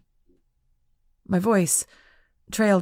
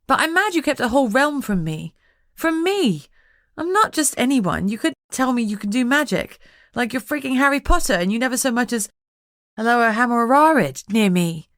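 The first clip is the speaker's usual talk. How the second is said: higher, louder indignant rant; 6 semitones higher, 4 dB louder than usual. A narrator's reading. Lucy scolding Jess for hiding the realm, higher and louder. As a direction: vent your hurt, voice rising.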